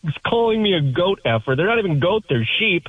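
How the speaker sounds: incredulous, cracking up mid-sentence; dying laughing. Retold or imitated. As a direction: laugh your way through the protest. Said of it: "I'm just dying laughing at this point".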